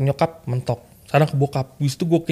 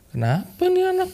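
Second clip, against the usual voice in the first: high mock-confused boss voice; puzzled boss; 3 dB quieter than usual. Retelling how the boss reacted, a high mock-confused boss voice. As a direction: put on a baffled boss, pitched way up. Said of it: "bos gua bingung kayak".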